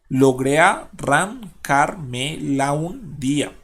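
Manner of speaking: "logré arrancarme la un día" slowly split into syllables, a gap after each. Shown as a demonstration. Teacher dividing a verse for students, "logré arrancarme la un día" slowly syllable by syllable. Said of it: "vamos acá a dividir".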